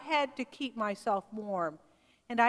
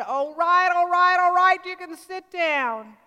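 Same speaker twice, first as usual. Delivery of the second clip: loud, higher all right, all right, calming by the end; giving in; 5 semitones higher, 8 dB louder than usual. Retold or imitated. Greasy apron cook giving in to the walkout, loud exasperated. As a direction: throw up your hands and give in out loud.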